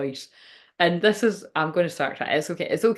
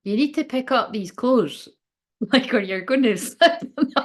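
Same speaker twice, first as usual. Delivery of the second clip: laughing through quote; 5 semitones higher than usual. Retold or imitated. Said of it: "she said".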